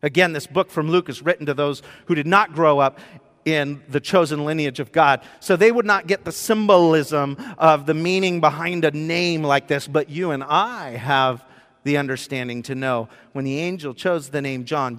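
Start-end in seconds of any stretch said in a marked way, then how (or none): none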